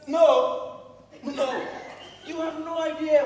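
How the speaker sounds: loud sharp refusal, half laughing; shouted protest; exasperated shout. Acted. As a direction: bark out a flat no, twice. Couple arguing about moving.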